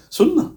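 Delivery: a high, sudden one-word answer; spring the word up high; emphatic answer. Real, not acted. Preacher answering his own question with emphasis.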